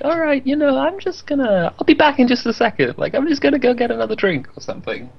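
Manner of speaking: high-pitched guy at a party; party guy excuse. Retold or imitated. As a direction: sneak off for another drink. Party guy slipping away.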